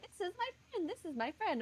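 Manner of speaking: high, eager mock introductions; Max. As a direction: lightly imitate someone keen to show off friends. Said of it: "Max would be like".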